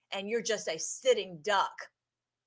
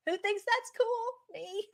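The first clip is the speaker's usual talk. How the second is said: high and slow, thrilled question; asking viewers, delighted and rising; excited; 11 semitones higher, slower than usual. Real, not acted. Vlogger asks her viewers, giddy high excitement.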